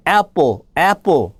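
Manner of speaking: "apple" with a wide open æ; "apple" with jaw dropped on the A; English short A. Shown as a demonstration. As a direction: say "apple" slowly, stretching the first vowel. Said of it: "이 발음, 애 발음".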